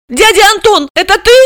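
put-on very high, piping girl; high-pitched childish question; little girl's voice. A narrator's reading. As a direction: squeak it as a young girl asking. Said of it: "Лишь Алиска спросила".

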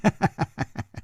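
high cackling giggle; giggling laugh. A narrator's reading. Mocking stranger over the fallen fighter, high giggle. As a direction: giggle in a high, childlike character voice. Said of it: "咯咯的笑着说".

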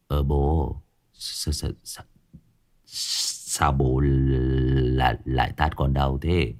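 low, slow, stumbling sleepy voice; groggy, just awake. A narrator's reading. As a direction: mumble haltingly as if dragged out of sleep. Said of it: "Nó lờ đờ mở mắt". (giọng ngái ngủ, lờ đờ)

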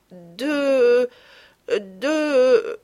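put-on a blushing girl who can't go on; embarrassed stammer. Madeleine blushes and stalls before the lady, stammering. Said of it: "Madeleine rougit et s'arrêta".

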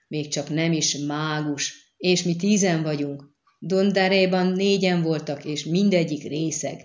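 usual speaking voice

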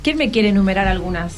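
ordinary talk, nothing marked

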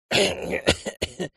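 raspy choked cough; hoarse cough. Acted. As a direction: cough out a throat that was just squeezed. Choked thief coughs after release.

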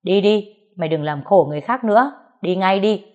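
loud and quick, pushing her out; scolding shout. Acted. Aunt scolding niece to leave at once, shouting. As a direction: order her away in a harsh scold. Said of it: "Cô nó kéo nó đứng lên rồi quát".

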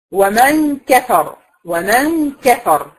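very slow ومن كفر, held nasal n, heavy r without vowel; stop on heavy ra. Shown as a demonstration. Teacher shows a temporary sukun, reciting كفر slowly to a stop. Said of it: "وقفت عليها اديتها السكون".

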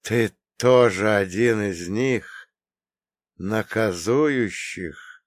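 slow, rasping question; hoarse voice. Acted. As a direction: drag it out hoarsely. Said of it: "Его голос был хриплым".

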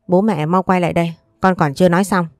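ordinary delivery, nothing marked

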